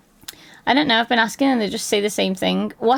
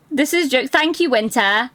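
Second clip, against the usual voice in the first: usual speaking voice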